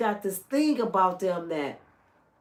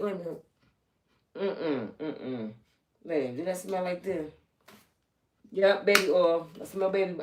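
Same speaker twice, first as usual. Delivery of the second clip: mock sniff check; 3 semitones lower than usual. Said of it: "walking up to people like".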